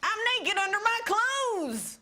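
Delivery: put-on high Southern drunk-uncle voice; comic Southern accent pitched high. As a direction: ham up a tipsy Southern uncle. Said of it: "I gotta do this in a Southern accent".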